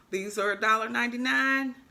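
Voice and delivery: ordinary talk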